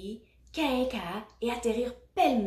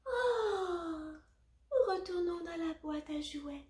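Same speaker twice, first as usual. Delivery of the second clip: high, soft words through a yawn; yawn, then talk through it; 8 semitones higher, 4 dB quieter, slower than usual. A narrator's reading. Sleepy Teddy to the toys, yawning. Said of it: "dit Teddy avec un bâillement".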